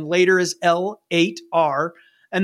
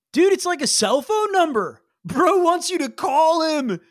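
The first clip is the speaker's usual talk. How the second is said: put-on excited surfer kid; 8 semitones higher than usual. Excited kid reads message.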